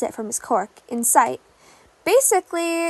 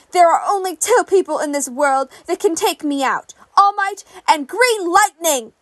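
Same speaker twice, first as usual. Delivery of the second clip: snarling, louder threats to a rival; furious villain threat; 3 dB louder than usual. Acted. Hero killer screams at the villain on the news, in furious rage. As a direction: spit every word at him in rage. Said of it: "his final words before he was carted off".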